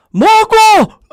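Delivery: huge high-pitched bellow of a name; booming imitation of a caller's shout; Big Davey. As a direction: bellow it like an over-keen phone-in caller. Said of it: "And Davey would just go".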